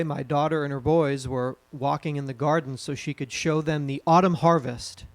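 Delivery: ordinary talk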